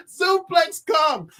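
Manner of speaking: slow, drawn-out hype shout; excited shout. Real, not acted. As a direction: call it out like a wrestling move. Hyped recap of fight.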